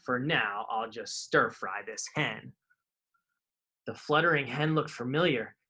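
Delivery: put-on lively read-aloud for kids; storybook reading. A narrator's reading. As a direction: tell the rhyming tale to children. Reading picture book aloud.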